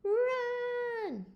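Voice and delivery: very high, loud scream; panicked yell. Acted. Goose fleeing the ghost, panicked yell.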